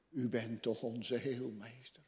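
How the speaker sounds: usual speaking voice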